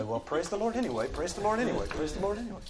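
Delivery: high singsong, running the repeats together; put-on pious cheer, pitched high; blissed-out believer. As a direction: chant it like a mindless mantra. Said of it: "put yourself into an altered state of consciousness".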